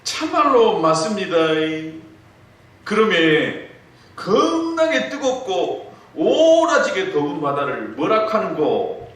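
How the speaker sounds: high, loud, slow Gyeongsang coaxing; 애교 넘치는 경상도 사투리; extra cute Gyeongsang wife. Retold or imitated. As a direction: lay the charm on thick in Gyeongsang dialect. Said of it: "더욱 애교를 부리면서".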